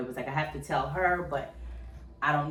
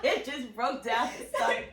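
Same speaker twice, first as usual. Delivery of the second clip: high laugh, slow words; laughing through it; 8 semitones higher than usual. Real, not acted. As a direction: laugh as you tell it. Laughing while retelling crying.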